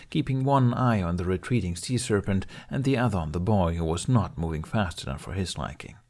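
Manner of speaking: ordinary delivery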